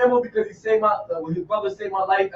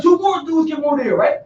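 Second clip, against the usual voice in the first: ordinary talk, nothing marked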